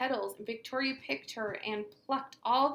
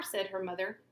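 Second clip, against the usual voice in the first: usual speaking voice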